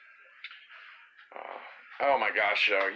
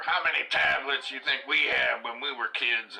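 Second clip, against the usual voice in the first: higher, mock-scolding parent lecture; parental lecture; 6 semitones higher, 4 dB louder than usual. Parent scolding kids upset over tablets, mock lecture. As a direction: lecture the kids with a rhetorical jab. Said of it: "getting into the lecture".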